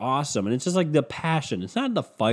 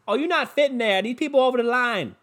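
put-on Boston accent; 11 semitones higher than usual. Mimicking the Hyundai commercial, hammed-up Boston accent. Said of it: "they're fucking talking in that Boston accent".

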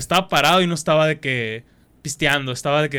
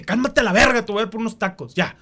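mock scolding; 6 semitones higher than usual. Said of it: "Y le dije".

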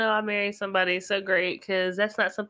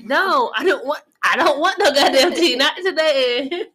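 louder, exasperated no to the tea; mock annoyed refusal; 3 semitones higher, 6 dB louder than usual. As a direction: push the tea away, fed up.

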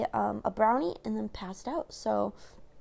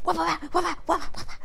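higher, mock brawling wah sounds; cat fight noises; 6 semitones higher, faster than usual. Retelling the brawl as squabbling cats, higher wah noises. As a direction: imitate two cats bopping each other. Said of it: "these other guys are just like".

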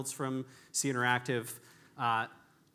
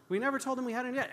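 "We never told him we had any debt" rushed, higher; fast and high; 11 semitones higher, much faster than usual. Shown as a demonstration. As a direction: rattle it off fast, pitched up.

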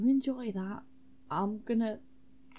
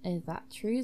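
normal talking voice

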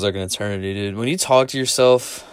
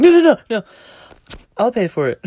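eager insistence; 13 semitones higher, 7 dB louder than usual. Retold or imitated. Eager offer to pay, laughing.

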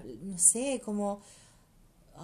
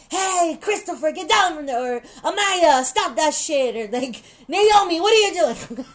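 loud, high yell across the playground; calling out to kids; 6 semitones higher, 10 dB louder than usual. Retold or imitated. Parent in the park scolding the children, a loud high call. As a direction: shout the kids' names to stop them. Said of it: "llamándole la atención a nuestros hijos".

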